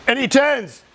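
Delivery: high-pitched call to the room. Real, not acted. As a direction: call it out to the whole audience. Calling for a show of hands.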